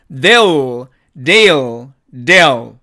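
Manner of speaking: "they'll" as "dei-o", L left open; contracted they'll. Shown as a demonstration. Teacher modelling they will shortened, contracted. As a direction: glide "they'll" into "de-o", no closed L. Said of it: "이렇게 발음이 되고요".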